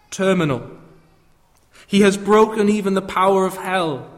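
rising, fervent preaching voice. Acted. Preaching builds to triumphant climax.

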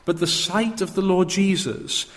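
ordinary talk, nothing marked

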